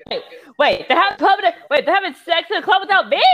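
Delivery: high-pitched, loud squawk of protest; outraged disbelief. Acted. Friend learning the couple had fun without them, outraged yelp. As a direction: yelp it in hammed-up indignation. Acted outrage at being left out.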